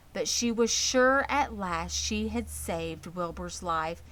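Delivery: usual speaking voice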